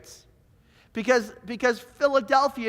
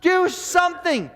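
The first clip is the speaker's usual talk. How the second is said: higher, louder frustrated fan shout at the screen; yelling at the TV; 8 semitones higher, 3 dB louder than usual. Retold or imitated. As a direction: bark orders at the TV like a sore fan. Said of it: "I will start yelling at the T V".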